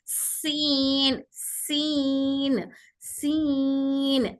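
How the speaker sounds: सीन stretched very slowly with a long airy s; very slow teaching demo of सीन; stretched seen. Shown as a demonstration. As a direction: hold the hiss in सीन. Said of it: "अगर हवा की sound सुनाई दे तो आप सही हैं".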